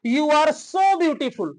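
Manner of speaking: slow, deliberate, so stressed; slow example sentence. Shown as a demonstration. Teacher models an example sentence slowly for students. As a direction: lay it out slowly for the class. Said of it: "अब इसमें क्या अंतर है?".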